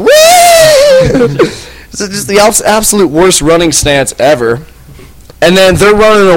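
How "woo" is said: put-on very high shouted drunken woo; wild woo. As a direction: whoop it like a drunk charging around. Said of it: "running around with fucking propeller arms going".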